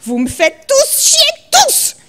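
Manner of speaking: very high, loud slow rage scream; roaring. Acted. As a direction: explode at all of them.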